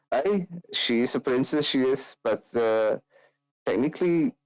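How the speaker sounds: lightly voiced teasing brother; Ismark. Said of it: "Ismark just kinda laughs and just goes".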